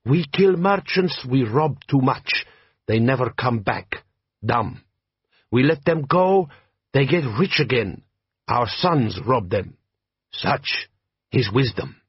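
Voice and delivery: put-on slow, heavy-accented bandit chief; lightly voiced warlord, measured and proud; Jabbar, desert chieftain. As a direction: lean into a slow Klatchian drawl. Jabbar speaking.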